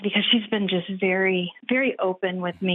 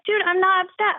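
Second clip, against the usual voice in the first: higher-pitched, mock-exasperated protest; retold protest; 11 semitones higher, 3 dB louder than usual. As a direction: insist you are not upset, pitched high. Retold spat, protesting to husband.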